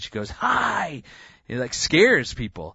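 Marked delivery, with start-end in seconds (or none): 0.3-1.0 bright high yell to a whole plane; child yelling hi. Retold or imitated. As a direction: call out to everyone, high and loud. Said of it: "kinda yells out as loud as she could".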